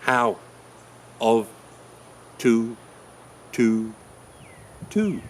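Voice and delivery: "how, of, to, to, to" as isolated, punched single words; first syllables stressed. Shown as a demonstration. Teacher shows first syllables mean nothing, each stressed. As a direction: hit each of "how, of, to, to, to" alone, pausing between. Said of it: "If you stress the first syllable".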